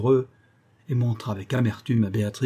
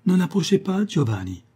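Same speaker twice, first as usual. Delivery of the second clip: high, louder warning cry; terrified cry; 9 semitones higher, 4 dB louder than usual. A narrator's reading. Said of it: "s'écria-t-elle avec terreur".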